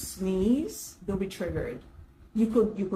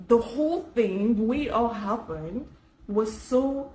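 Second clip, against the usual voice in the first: ordinary delivery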